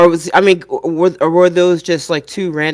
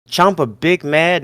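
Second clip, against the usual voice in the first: usual speaking voice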